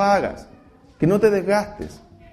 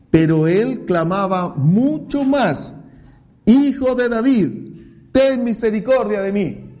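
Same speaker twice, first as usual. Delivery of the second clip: slow, drawn-out scripture reading; reading scripture aloud; 4 semitones higher, slower than usual. Acted. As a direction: read the verse slowly and with weight. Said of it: "Sigue el versículo treinta y nueve diciendo". (lectura lenta y solemne del versículo)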